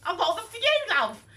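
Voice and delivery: sweet high friend handing over a present, cute voice. Retold or imitated. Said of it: "She had this cute voice".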